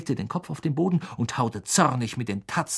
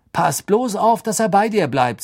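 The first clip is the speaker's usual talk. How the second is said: higher, slightly irritated warning; 7 semitones higher, 5 dB louder than usual. A narrator's reading. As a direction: warn with a touch of annoyance. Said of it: "sagte Ron gereizt". (leicht gereizt warnend)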